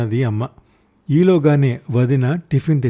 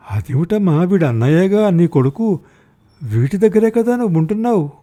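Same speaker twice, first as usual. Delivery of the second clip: louder, higher, urging a confused mother; raised voice; 6 semitones higher, 4 dB louder than usual. Acted. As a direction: press the point loudly. Son urges confused mother. (తికమకపడిన తల్లిని గట్టిగా అడగడం)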